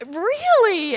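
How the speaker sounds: high, loud mock amazement; hammed-up amazed lady; amazed really. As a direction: gush it like a delighted sample lady. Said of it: "and she's like".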